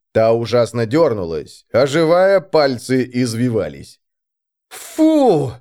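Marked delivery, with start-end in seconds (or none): 4.7-5.5 high, recoiling "phew"; disgusted "ew". A narrator's reading. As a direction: let out a high yuck while backing away. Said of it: "одновременно сказали брат Диас и Алекс, отступая".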